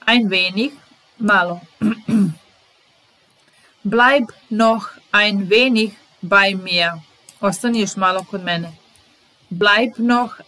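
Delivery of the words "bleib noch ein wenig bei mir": slow, careful teacher reading; deliberate classroom pace; slow model reading. Shown as a demonstration. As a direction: spell each word out slowly for the learner. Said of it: "teacher models phrase slowly".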